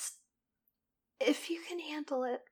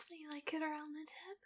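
whispering; 11 dB quieter than usual. Acted. Sensual whispered roleplay.

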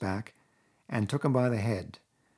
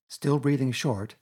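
plain reading voice

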